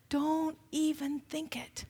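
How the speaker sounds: preacher's hushed refrain; hushed warning. Shown as a demonstration. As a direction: breathe it as a quiet caution. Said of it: "repeated warning".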